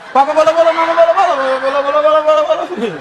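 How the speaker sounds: hammed-up bola bola as if the head is spinning; Tinatun-style tongues. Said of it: "persis Tinatun".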